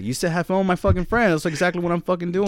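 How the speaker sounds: high, loud mock-indignant comeback; defiant retort. To his girlfriend, loudly and indignantly quoting her back.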